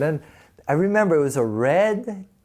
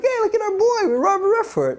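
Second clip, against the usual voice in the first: much higher, gleeful bragging; giddy excitement; 4 dB louder than usual. Retold or imitated. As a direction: act like you just made it big. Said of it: "we're like".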